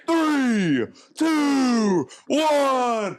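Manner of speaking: high, loud countdown; countdown call. Pretend. Race countdown called out.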